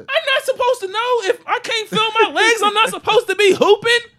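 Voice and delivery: high, loud indignant laughing rant; outraged disbelief. Real, not acted. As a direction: shout it high through laughter. Outraged at dad's disappointment.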